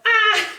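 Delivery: short, high "ah" shriek, held in check; mock panicked scream. Carlos with Max in his mirror, high mock scream. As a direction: yelp as if being chased. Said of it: "like, going".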